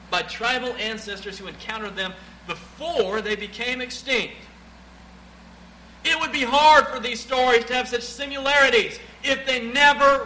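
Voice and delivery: loud, high, driving sermon voice; preaching at full force. Acted. Preacher quoting Henry Morris to the church, booming. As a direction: build it up and hammer it home.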